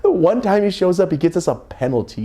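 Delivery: a fed-up mom venting about the penalty; exasperated complaint. Retold or imitated.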